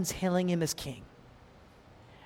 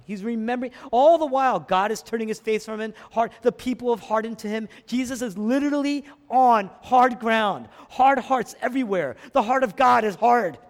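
raised, pounding pulpit delivery; full-force preaching; 6 semitones higher, 6 dB louder than usual. Real, not acted. Preacher describes hard hearts around Jesus, full-force preaching. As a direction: hammer each phrase at the crowd.

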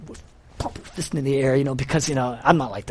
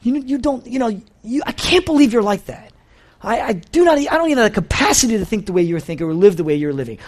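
raised, scornful disbelief; mock outrage at a spouse, pitched up; indignant self-righteous quote; 6 semitones higher than usual. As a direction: sneer at someone who doesn't live like you. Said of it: "And we say".

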